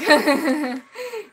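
sudden breathy laugh; a short real laugh; burst of laughter. Real, not acted. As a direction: laugh it off with the squad. Laughs at mythic fight.

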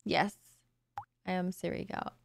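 normal talking voice

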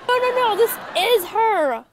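flustered denial. Acted. Boyfriend correcting the accusation, emphatic flustered denial.

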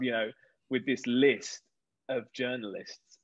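normal talking voice

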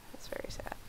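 breathy mock-sad whisper; soft mock-mournful whisper. Shown as a demonstration. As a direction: whisper it under your breath. Repeats line whispered.

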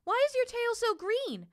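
high little bird voice, asking; high, wondering bird question; curious little bird. A narrator's reading. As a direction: ask the lion like a curious small bird. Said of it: "she said".

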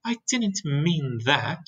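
ordinary delivery, nothing marked

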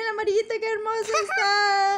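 high cooing over something cute. Acted. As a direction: coo it like over a baby. Admira al personaje tierno. (arrullo tierno y agudo)

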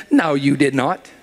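ordinary talk

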